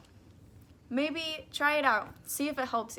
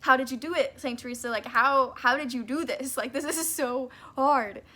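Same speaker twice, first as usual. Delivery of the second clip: loud, exasperated plea to a saint; frustrated outburst; 7 dB louder than usual. Retold or imitated. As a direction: vent the frustration, half-laughing at yourself. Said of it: "getting frustrated even more".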